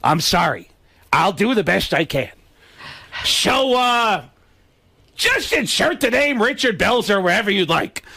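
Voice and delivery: stalling stage comic voice; Gilbert Gottfried. Acted. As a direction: start sheepish, then climb into a squawky impression. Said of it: "You can hear him apologizing".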